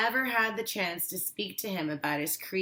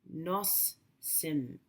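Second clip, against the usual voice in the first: soft, hushed Cree word; whispered; 7 dB quieter than usual. A narrator's reading. Grandfather softly saying granddaughter in Cree, whispered. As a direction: breathe it out tenderly. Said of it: "he whispered".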